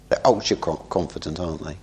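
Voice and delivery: soft, falling aside on ultra confident; a hushed, knowing remark; dropping quiet. Real, not acted. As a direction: let the voice sink away as you say it.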